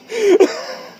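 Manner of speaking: high-pitched helpless giggle; a squeaky burst of laughter. Real, not acted. Vlogger giggling at his own intro. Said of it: "I'm sorry".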